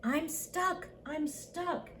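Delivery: small, high, soft plea; little truck calling for help in a high voice; high-pitched, helpless storybook cry. A narrator's reading. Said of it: "cried the little blue truck".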